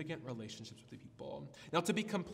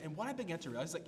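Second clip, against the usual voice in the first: normal talking voice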